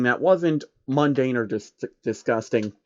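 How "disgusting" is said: usual speaking voice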